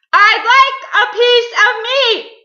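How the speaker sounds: very loud, high, drawn-out holler; booming shout. A narrator's reading. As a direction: boom it out at full volume. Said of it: "he boomed".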